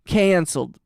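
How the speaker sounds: ordinary delivery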